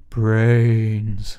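slow moaning zombie brains. Retold or imitated. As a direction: groan it like the undead. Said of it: "you said it earlier, Johnny".

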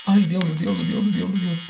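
high, frightened cursing; startled panic. Real, not acted. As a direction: blurt out in fright. Sudden scare. (susto repentino)

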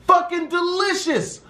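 high, drawn-out savoring of fucking delicious; savoring repeat. Shown as a demonstration. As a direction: linger on fucking delicious like you can still taste it. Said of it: "and the food is fucking delicious".

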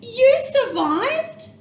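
high, loud and slow in disbelief; astonished cry. A narrator's reading. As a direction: stretch the words out in wonder. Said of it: "cried Stellaluna".

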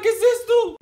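surprised. Pretend. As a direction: ask it astonished.

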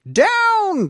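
high, loud commanding call; urgent cry. A narrator's reading. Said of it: "cried the witch".

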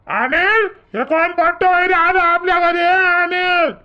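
high, carrying call for someone indoors. Acted. Old man calls Anil about a stranger in the house, calling out. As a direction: raise the pitch and call across the house.

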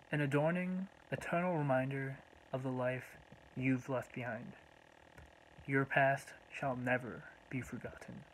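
measured poem reading, long pauses between short phrases; poem recitation. Acted. Poet reading a tribute to the Hamu family, measured recitation. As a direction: recite line by line, letting each phrase stand alone.

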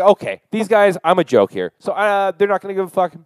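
loud, high fed-up rant; exasperated rant. Retold or imitated. Stranded traveller fuming at cops who laughed at him. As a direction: vent at the useless cops, voice up.

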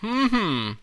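high drawn hum of a king; brummelnder König. A narrator's reading. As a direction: hum pompously, starting high. King hums, displeased. (königliches Hm, hoch angesetzt)

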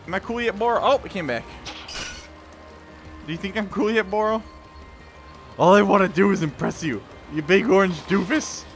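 ordinary talk

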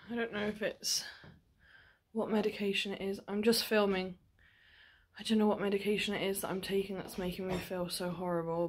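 ordinary talk

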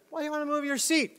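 high, quick attendant voice; mock flight attendant. Flight attendant questioning a passenger's seat request, mock puzzled. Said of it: "the flight attendant".

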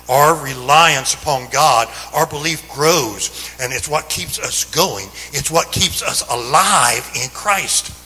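fervent Sunday preaching, ringing out; preaching at full force. Acted. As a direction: drive the message home to the congregation.

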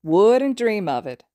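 slow, loud teasing sing-song. Acted. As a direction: tease him in a singsong. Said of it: "Sero sing-songed".

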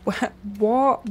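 usual speaking voice

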